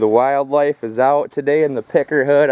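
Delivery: ordinary delivery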